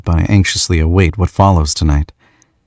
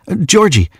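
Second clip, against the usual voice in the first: much higher, flustered name; flustered young man; 13 semitones higher than usual. Acted. Flustered young man blurts his nickname, higher. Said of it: "he says in a rush".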